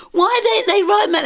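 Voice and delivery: high, loud mock exasperation; acted indignant retort. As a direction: imitate an exasperated student calling out.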